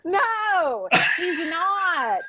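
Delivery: high, firm real protest; emphatic no. Real, not acted. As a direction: shut the idea down with a laugh in it. Emphatic denial to co-host.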